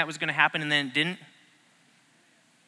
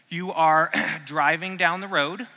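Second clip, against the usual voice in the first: ordinary talk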